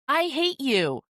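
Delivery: put-on high, whiny kid shouting I hate you; mocking child. Child's insult quoted by parent coach.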